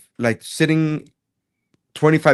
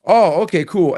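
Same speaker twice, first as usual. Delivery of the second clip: mock surprised; 7 semitones higher, 4 dB louder than usual. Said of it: "And I was like".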